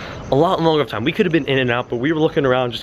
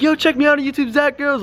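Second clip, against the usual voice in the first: high-pitched call, held back in volume; mock yell; 10 semitones higher than usual. Plugging his channel to the Groms, high mock yell. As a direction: imitate calling out a plug to strangers. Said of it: "this kid's yelling out the window".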